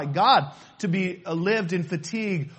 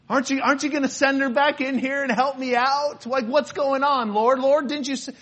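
higher, louder exasperated complaint to the Lord; mock frustrated Martha whining; 6 semitones higher, 4 dB louder than usual. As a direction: plead like an exasperated sister. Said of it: "Martha was pretty frustrated".

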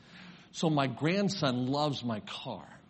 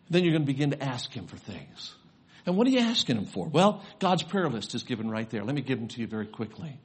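normal talking voice

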